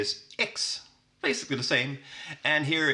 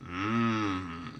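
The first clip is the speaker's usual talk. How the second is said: appreciative mm; 4 semitones lower than usual. Shown as a demonstration. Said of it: "you get".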